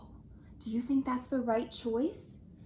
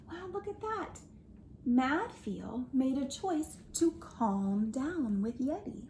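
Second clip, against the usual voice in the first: ordinary delivery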